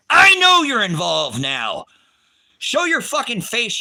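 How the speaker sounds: loud, slow defiant challenge; furious defiance. Real, not acted. To the federal agents watching, a loud, slow challenge. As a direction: yell it straight at the feds. Defying the feds.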